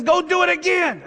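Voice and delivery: very high, emphatic order; insistent command. Retold or imitated. Elijah ordering water a third time, high insistent command. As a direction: pitch the order way up.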